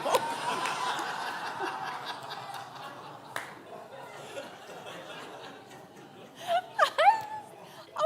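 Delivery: long high laugh breaking into crying; real laughing cry, high and long; laughing to tears. Real, not acted. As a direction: laugh so hard it turns to tears. Son's joke about meds.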